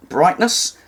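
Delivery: ordinary talk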